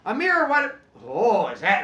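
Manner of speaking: high, loud startled teacher; mock surprise. Teacher spotting a hand in a kid's face, loud mock surprise. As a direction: react as if caught off guard. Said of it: "And I'm like".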